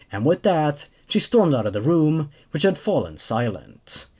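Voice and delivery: steady, even storytelling read; story narration. A narrator's reading. Host reading a creepypasta to his viewers, calm narration. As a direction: carry the tale along calmly.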